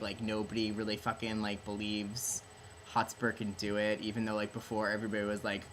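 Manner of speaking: ordinary talk, nothing marked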